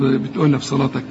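ordinary delivery

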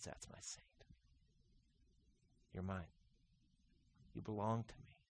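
soft, intimate whisper of love; tender whisper. Acted. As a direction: hush it gently like to a child. God voiced tenderly, whispered.

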